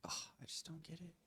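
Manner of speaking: breathy whispered aside. Real, not acted. Watching the fox video, whispering to himself. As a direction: mutter it under your breath.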